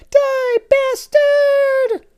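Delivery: very high, very slow loud yell; shouting. Acted. Tinky Winky yelling at Dumbledore, very high. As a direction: stretch the battle cry out. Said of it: "and shouted".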